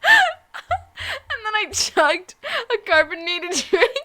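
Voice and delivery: high, loud laughing through words; crying with laughter; laughing hard. Real, not acted. Laughing fit about chugging soda.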